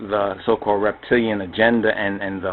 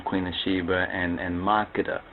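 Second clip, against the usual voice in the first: normal talking voice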